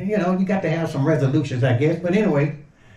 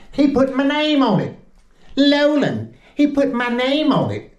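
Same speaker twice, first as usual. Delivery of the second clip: excited; 8 semitones higher than usual. Real, not acted. Thrilled by a personalized apron.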